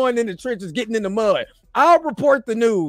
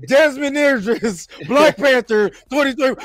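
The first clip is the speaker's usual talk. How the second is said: NFL draft announcer; 4 semitones higher than usual. Acted. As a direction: call out the pick to the whole arena. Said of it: "announce that shit like the N F L draft".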